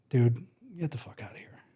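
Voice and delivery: unvoiced, whispered brush-off; hushed retort. Musician to pushy patron, a whispered get out. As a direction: breathe it out without voice, dismissive. Finally telling off the heckler.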